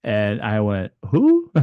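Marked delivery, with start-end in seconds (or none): 1.1-1.5 high-pitched incredulous squeak; stunned. Retold or imitated. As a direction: blurt it in comic disbelief. Stunned reaction to the archangel.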